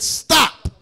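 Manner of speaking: loud, firm "Stop."; commanding shout. Pretend. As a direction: order the elephant to halt. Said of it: "tell an elephant".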